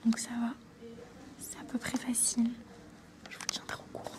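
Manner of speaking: soft unvoiced whisper, keeping quiet; hushed whisper. Real, not acted. Nervous student whispering to viewers before her English oral. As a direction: whisper so nobody in the exam room hears.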